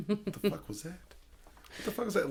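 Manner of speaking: high, hushed mock alarm; acted spooked reaction. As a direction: act startled by a noise in the woods. Spooked alone in the woods.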